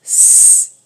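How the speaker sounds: S said as a long hiss, sss; letter sound. Shown as a demonstration. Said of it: "commençons par lire des syllabes".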